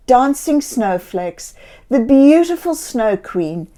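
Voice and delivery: plain reading voice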